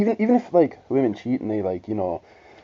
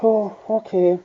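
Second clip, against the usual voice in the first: higher, airy mock girlfriend voice; 8 semitones higher, slower than usual. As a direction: mimic her dismissive reply. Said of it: "She's like".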